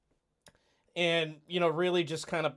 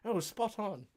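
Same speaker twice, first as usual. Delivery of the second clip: light mock British accent, softer; 5 semitones higher, 6 dB quieter than usual. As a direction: answer back as a pretend Brit. Replies in same mock accent.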